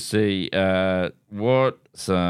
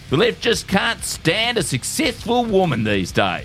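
higher, pumped-up talk radio ranter; conservative shock jock; 8 semitones higher than usual. Acted. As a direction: rant like an outraged radio host. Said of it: "in the voice of a conservative shock jock".

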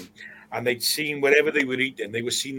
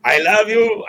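put-on warm Spanish-accented declaration, higher and louder; 8 semitones higher, 10 dB louder than usual. [name] declares his love to Kevin in a Spanish accent. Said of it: "I'm doing a Spanish accent here".

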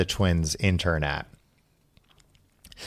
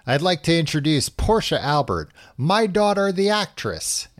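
higher-pitched stage introduction; mock proud announcement, pitched up; proud father announcing; 6 semitones higher than usual. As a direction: present your daughter to a crowd. Proud father introduces actress daughter.

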